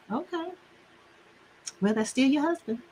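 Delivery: imitating mom, high and softly spoken; mother's voice. Mom hearing complaints about the husband, softly imitated. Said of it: "and she'd say".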